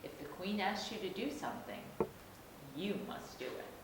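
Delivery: ordinary delivery, nothing marked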